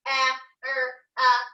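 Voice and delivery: "A, er, ah" as separate sounds with gaps; sounded out. Shown as a demonstration. Teacher repeating camera's sounds for pupils, "A, er, ah" one by one. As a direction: isolate each sound in "A, er, ah". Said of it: "sand out the words one more time".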